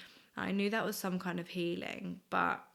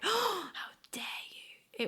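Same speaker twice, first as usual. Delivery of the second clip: mock outrage; 11 semitones higher, slower than usual. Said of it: "And he wasn't like".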